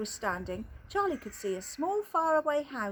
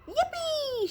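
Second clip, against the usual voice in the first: high gleeful shout. Acted. Grandpa Joe cheers as the lift shoots up, high whoop. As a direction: squeal it way up high with joy. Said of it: "shouted Grandpa Joe".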